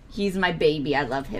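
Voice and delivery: tender. Real, not acted. Tender about her dog.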